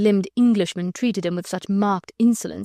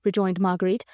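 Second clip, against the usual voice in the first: ordinary delivery, nothing marked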